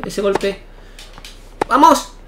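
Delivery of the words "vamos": high, loud shout of triumph; real cheer. Real, not acted. Gamer cheering his point, high loud shout.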